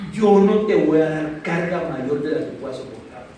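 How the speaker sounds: low, slow divine answer; voice of God. Retold or imitated. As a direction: speak down slowly as God answering. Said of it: "oí una voz que me dijo".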